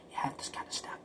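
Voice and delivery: whispered echo of the line before. Shown as a demonstration. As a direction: repeat it under your breath. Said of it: "whispered echo of the previous line".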